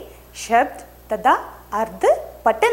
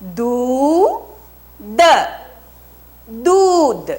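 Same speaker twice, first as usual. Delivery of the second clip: drawn-out model word; 4 dB louder, much slower than usual. Shown as a demonstration. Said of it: "teacher models word for class".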